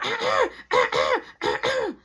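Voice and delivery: high, drawn-out run of throat clears; exaggerated throat clearing. Friends try to get his attention, exaggerated throat clearing. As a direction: mimic friends pointedly clearing their throats. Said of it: "clearing their throat to get my attention".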